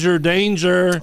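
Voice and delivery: high, sing-song warning; drawn-out, playful alarm; mock warning. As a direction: chant it slowly like a playground caution. Listener chimes in warning.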